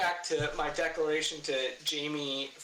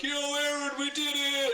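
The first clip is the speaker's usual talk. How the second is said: triumphant holler; 11 semitones higher, 3 dB louder than usual. Retold or imitated. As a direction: imitate a friend calling out in triumph. Friend's triumphant message acted out.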